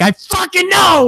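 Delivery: high exasperated yell; frustrated shout. Retold or imitated. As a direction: yell it, completely fed up. Player fed up with repetition.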